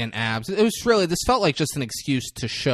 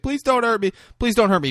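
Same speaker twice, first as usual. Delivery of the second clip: high, begging plea; pleading; 10 semitones higher, faster than usual. Retold or imitated. Scared woman begging the man not to hurt her.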